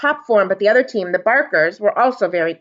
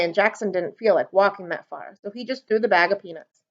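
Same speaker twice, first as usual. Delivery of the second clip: ordinary delivery, nothing marked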